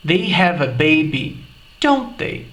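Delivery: "don't they?" falls, confirming; statement seeking confirmation, "don't they?" falling; falling tag intonation. Shown as a demonstration. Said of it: "eu disse".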